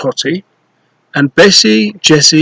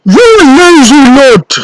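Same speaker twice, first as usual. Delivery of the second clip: much higher, loud furious roar; giant roaring; 10 semitones higher, 5 dB louder than usual. Acted. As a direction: roar at the lazy children. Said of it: "roared the giant".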